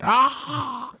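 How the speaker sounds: shrill pterodactyl screech up high; high bird shriek. Pretend. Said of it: "That's my bird sound".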